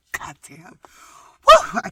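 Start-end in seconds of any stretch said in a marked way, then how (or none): 1.5-1.8 a loud high whoop; excited whoop. Real, not acted. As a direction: let out a joyful woo. Reacting to the story.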